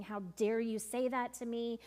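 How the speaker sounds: normal talking voice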